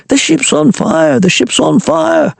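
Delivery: high mock panic cry; mild mock yell; mock screaming. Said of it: "everyone would be running around screaming".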